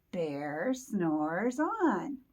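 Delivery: very slow, stretched words; slow drawn-out refrain. Acted. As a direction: stretch each word sleepily. Refrain read for children.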